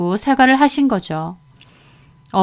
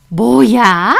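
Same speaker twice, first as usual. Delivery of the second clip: louder, indignant challenge; affronted outburst; 5 dB louder, much slower than usual. A narrator's reading. Mother-in-law hearing her daughter-in-law talk back, indignant. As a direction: throw it back, outraged.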